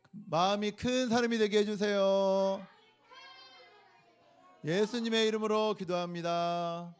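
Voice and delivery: slow, earnest prayer lead with long pauses; gentle pastor leading a prayer; children's prayer. Acted. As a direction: pray simply so kids can follow. Children's sermon closing prayer.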